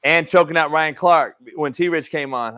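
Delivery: out of breath. Real, not acted. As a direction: still winded, breathless. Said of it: "I'm still out of breath".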